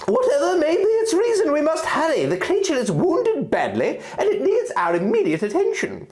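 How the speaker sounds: very high, pressing fairy voice; a tiny fairy urging haste; high-pitched fairy pleading for speed; urgent fairy. Acted. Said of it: "with urgency in her voice".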